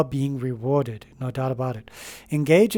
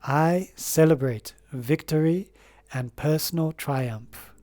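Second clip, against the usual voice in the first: slow, deliberate affirmation with long pauses; said slowly; slower than usual. Shown as a demonstration. Reader dictating an affirmation to viewers, spoken slowly. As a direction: dictate each phrase slowly so they can write it down. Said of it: "I'll say it slowly".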